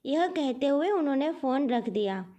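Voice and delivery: ordinary delivery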